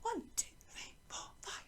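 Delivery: very soft whispered counting. Shown as a demonstration. Teacher counting the long note for learners, whispered. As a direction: whisper the beats one by one. Counting out the held note's beats.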